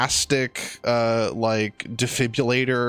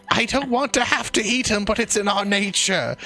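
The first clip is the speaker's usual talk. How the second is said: much higher, sweet welcoming pig; 9 semitones higher than usual. Acted. Pig shopkeepers welcoming a customer, high and sweet. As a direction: coax a customer in, squeaky. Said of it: "And the pigs say".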